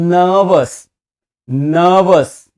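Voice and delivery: नर्वस with silent r, long first vowel; corrected pronunciation. Shown as a demonstration. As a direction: say नर्वस loud as "nuhh-vus". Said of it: "वो भी नहीं".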